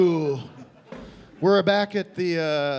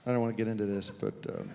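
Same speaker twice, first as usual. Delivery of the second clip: reluctant, quiet; 6 semitones lower, 8 dB quieter, faster than usual. Acted. Reluctant confession.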